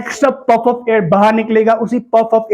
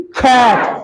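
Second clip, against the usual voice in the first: ordinary talk, nothing marked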